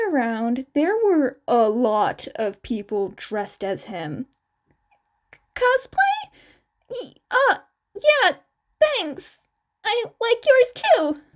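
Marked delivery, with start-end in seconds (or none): 5.5-11.2 high, startled squeak; a nervous squeak, caught off guard. A narrator's reading. Izuku answers the cosplayer in a panicked high squeak. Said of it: "he squeaked".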